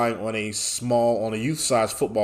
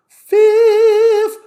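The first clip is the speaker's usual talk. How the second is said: squeaky high voice; 13 dB louder than usual. Pretend. Playful squeak while spelling handle.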